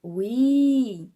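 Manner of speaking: "O i" with the i held long and loud; long stressed i. Shown as a demonstration. Teacher proving the strong syllable lasts longer, i drawn out. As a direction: stretch out the i in "O i" loudly. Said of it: "não saiu mais tempo?".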